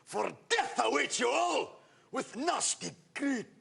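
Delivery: roaring sorcerer voice, high and slow; Tim the Enchanter. Acted. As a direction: thunder the warning like a mad wizard. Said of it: "who says things like".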